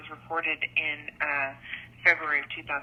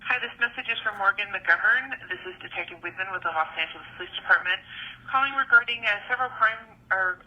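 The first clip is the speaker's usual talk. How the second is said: recorded phone message; leaving a voicemail. Real, not acted. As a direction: voicemail over a phone line. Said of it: "here's a phone call I received".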